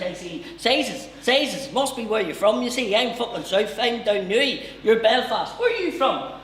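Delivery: loud, high, laughing Newry man; shrill giddy Newry blether; Newry accent. Retold or imitated.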